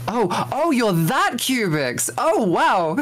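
high, gushing fan squeal with a laugh; starstruck fan. Retold or imitated. Fan on Discord recognizing Cubics, high and gushing. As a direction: play the awed fan who recognizes him, high and giddy. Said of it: "and they'll be like".

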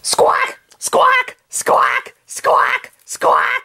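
shrill, breathy parrot screeches; parrot squawks. Acted.

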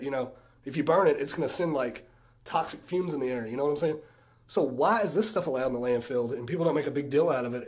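usual speaking voice

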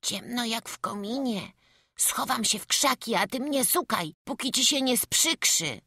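put-on high child's voice, pleased with his plan; high-pitched, defiant kid; runaway little boy. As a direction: talk to yourself as a cocky small boy. Voices the boy running away.

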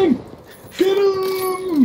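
shout. Real, not acted. Charging enemy in game.

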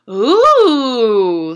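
put-on the girls amazed by Amy's tutu, a long awed ooh; impressed ooh. A narrator's reading. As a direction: let the whole group marvel. Girls see Amy's costume.

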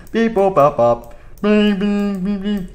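high beep boop dialing sounds; phone dialing noises. Acted. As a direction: mimic the phone keypad.

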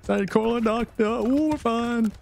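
high, drawn-out mock distress call; mock panic.

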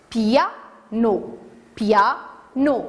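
"piano" split into pia, no; syllable by syllable. Shown as a demonstration. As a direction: say "piano" in two separate beats. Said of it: "Combien y a-t-il de syllabes".

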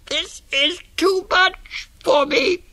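very high, slow pinched nasal wail; through the nose. Acted. Elephant child, nose stretched, high nasal complaint. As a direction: squeal each word through a blocked nose. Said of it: "he said through his nose".

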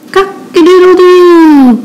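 high, slow, drawn-out crow; rooster crow. A narrator's reading. Rooster calling to the spider, high crowing. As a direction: crow it out, holding the last note. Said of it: "crowed the rooster".

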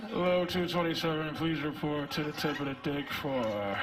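low, even intercom announcer; store PA announcement. Pretend. Sperm paged over the intercom, flat announcer voice. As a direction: read it like a store loudspeaker. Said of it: "shit comes out like".

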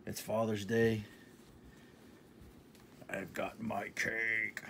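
ordinary delivery

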